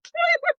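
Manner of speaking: high, loud laughing cry; emotional burst of laughter. Real, not acted. Reader overwhelmed by the love scene, laughing cry. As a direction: let a startled, moved laugh burst out. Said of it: "I'm taken out".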